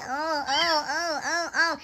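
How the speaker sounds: soft mock groans of a complaining pregnant woman; hammed-up moaning oh oh. Scene: vlogger imitating endless pregnancy complaints, mock moaning.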